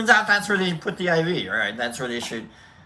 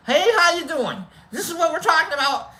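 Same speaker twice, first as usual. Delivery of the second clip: high, strained mock-cheerful voice; forced chipper; 12 semitones higher, 4 dB louder than usual. Patient chatting through the pain, high forced cheer. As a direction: chirp it while hiding the pain. Acting chipper while trying not to cry.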